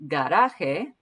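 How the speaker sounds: "Garaje" with a hard G and the J like an H; Spanish pronunciation. Shown as a demonstration. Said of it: "this is a hard G sound".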